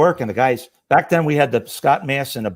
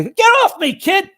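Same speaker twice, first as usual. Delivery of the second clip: much higher, louder mock yell; retold yell; 5 dB louder than usual. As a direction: shove the kid off with your voice. Firefighter shoving the rookie off.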